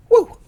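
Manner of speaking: short, high, loud whoop; excited woo. Real, not acted. Reviewer cheering after giving the movie's rating, excited whoop. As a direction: let out a quick high cheer.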